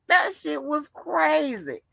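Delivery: ordinary delivery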